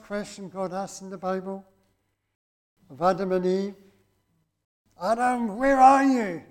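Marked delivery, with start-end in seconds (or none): 5.0-6.4 raised, louder calling voice of God. Acted. God calls out to Adam in the garden, raised and searching.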